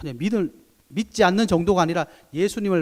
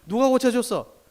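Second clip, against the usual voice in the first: higher, louder demanding question; mock demanding; 8 semitones higher, 6 dB louder than usual. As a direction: demand an answer sharply. (다그치는 흉내)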